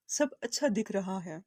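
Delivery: ordinary talk, nothing marked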